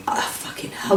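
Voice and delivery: unvoiced whispered oh fucking hell; a whispered gasp of relief; whispered curse. Relief remembered as thought.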